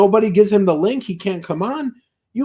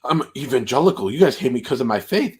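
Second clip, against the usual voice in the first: mocking Doug; 10 semitones lower than usual. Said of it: "Doug's like".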